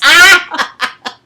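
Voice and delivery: high loud delighted laugh; delighted shriek laugh. Real, not acted.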